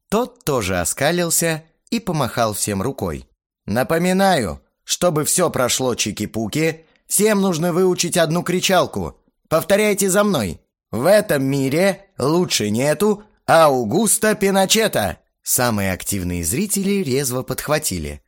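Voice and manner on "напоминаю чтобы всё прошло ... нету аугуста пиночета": loud rallying crowd call; cheerleader. A narrator's reading. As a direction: pump up the crowd. Leading crowd in a chant.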